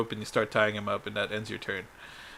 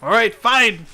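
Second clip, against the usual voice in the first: high, loud giving-in; exasperated surrender; 10 semitones higher, 9 dB louder, slower than usual. Acted. Cornered character grudgingly yields the scroll, loud exasperation. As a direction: give in loudly, voice jumping up.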